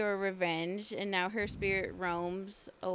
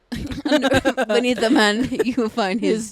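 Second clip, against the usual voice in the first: loud, slow dramatic announcer; 12 dB louder, slower than usual. Pretend. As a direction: boom it out slowly like a trailer. Dramatic mock narration.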